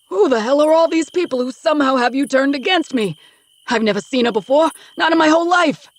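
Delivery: high, loud desperate denials; frantic denial. Acted. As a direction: shout him down and deny it all. Father shouts denials at son.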